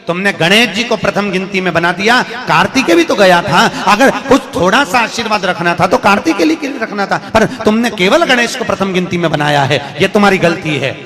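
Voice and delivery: loud, high scolding of a husband; furious wife accusing, acted in earnest; angry reproach. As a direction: lay the blame on him at full voice. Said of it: "माता पार्वती को गुस्सा तो बहुत आया".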